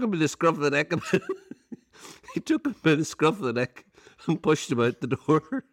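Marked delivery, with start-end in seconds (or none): 1.0-1.7 bursts into a high laugh; real amused laugh. Real, not acted. Laughing at own story.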